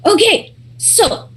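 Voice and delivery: high, winded, playing breathless; out of breath. Runner starting to explain the emergency, out of breath. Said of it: "I'm, like, very out of breath".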